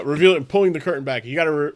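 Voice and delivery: ordinary talk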